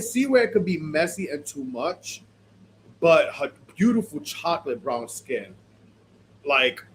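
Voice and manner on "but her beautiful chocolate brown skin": usual speaking voice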